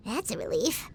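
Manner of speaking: ordinary talk, nothing marked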